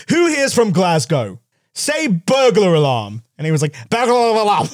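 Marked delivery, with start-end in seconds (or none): 0.1-3.2 slow, higher-pitched presenter's call; Jeremy Clarkson. Retold or imitated. Top Gear host challenging a Glaswegian, slow mock-presenter voice. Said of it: "Jeremy Clarkson was just like". 3.9-4.7 high-pitched, garbled Glaswegian burr; mangled Glasgow accent. Retold or imitated. Glaswegian failing to say burglar alarm, mock Scottish accent. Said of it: "the place where they can't s-, say burglar alarm".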